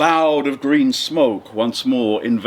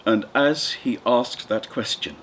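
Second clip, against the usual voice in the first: normal talking voice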